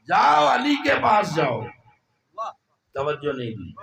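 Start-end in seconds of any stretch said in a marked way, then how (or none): 0.1-1.6 high, loud divine command; booming order with "جاؤ" hammered; commanding shout. Acted. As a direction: shout "جاؤ علی کے پاس جاؤ" as a ringing order. Voicing God's command, then repeated.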